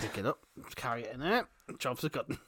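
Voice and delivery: low, soft muttered dog thoughts; quiet dog voice. Retold or imitated. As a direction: say it softly like a dog musing. Voicing dog's thoughts.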